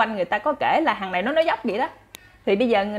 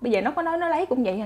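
normal talking voice

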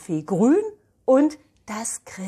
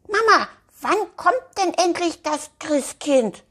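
high, louder child voice with a hint of sadness; sad little girl; 11 semitones higher, 5 dB louder than usual. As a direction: whine it like an impatient little girl. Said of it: "fragt Elfi mit trauriger Stimme". (Elfi fragt die Mama traurig, hohe Kinderstimme)

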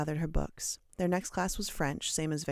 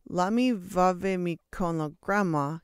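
uncertain fake French; 2 semitones higher, 4 dB louder, slower than usual. A narrator's reading. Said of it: "he said uncertainly".

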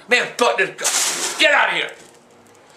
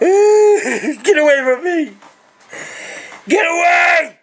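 laughing then shouting; 4 semitones higher, much slower than usual. Real, not acted. Old man to family, half-laughing angry shout. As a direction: push them away with a yell.

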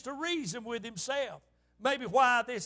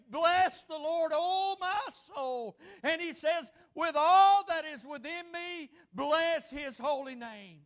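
proclaimed psalm; 3 semitones higher, slower than usual. Retold or imitated. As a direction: stretch out "oh my soul" in praise. Preacher proclaims the psalm with force.

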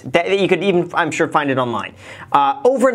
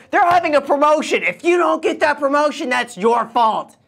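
pitched-up parody of a hype guru; mock guru voice; 9 semitones higher than usual. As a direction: lecture them like a smug self-help guru. Mocking a guru voice.

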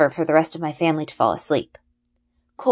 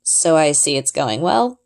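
dry; 2 semitones lower, slower than usual. A narrator's reading. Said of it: "Ari says dryly".

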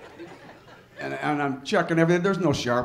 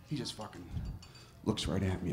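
ordinary talk

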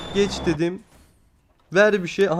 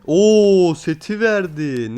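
excited; 3 semitones higher, 6 dB louder, much slower than usual. Real, not acted. Guaranteed reward gives set.

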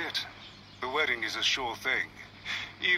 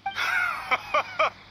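scornful laugh; 4 dB louder than usual. Acted. Scornful reaction to accusation.

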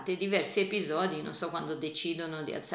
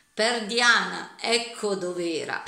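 Perdiana dragged out in outrage; indignant exclamation; 2 semitones higher, 7 dB louder than usual. A narrator's reading. George finding the butter, indignant loud exclamation. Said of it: "Indignato esclamò".

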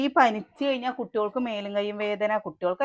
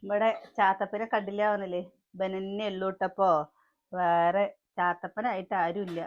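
usual speaking voice

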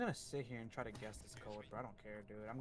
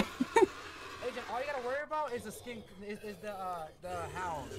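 panicking gamer; 6 semitones higher, 17 dB louder than usual. Real, not acted. Gamer panicking during chase.

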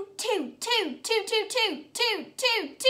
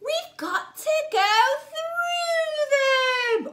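higher, louder, slow storybook drama; building dramatic refrain; 8 semitones higher, 4 dB louder, slower than usual. Acted. Teacher reading the lamb refrain to children, building slow and loud. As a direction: build the suspense, drawn out.

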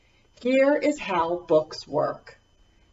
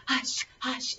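quiet falling hush; soft hush; 3 semitones higher, 10 dB quieter than usual. Real, not acted. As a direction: hush them gently. Hushing the kids.

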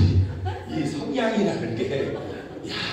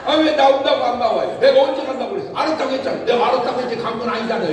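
higher and louder back-and-forth; bickering exchange; 5 semitones higher, 5 dB louder, faster than usual. Retold or imitated. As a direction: argue both sides of the church invitation. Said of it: "이렇게". (교회 안 나온 충청도 사람과 실랑이, 높고 크게 따지는 말투)

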